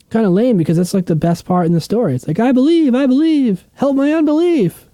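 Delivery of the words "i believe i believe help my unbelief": high, strained plea of a desperate father; pleading father. Retold or imitated. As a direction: beg like a father afraid for his son. Quoting the father's plea again.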